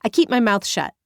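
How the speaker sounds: plain reading voice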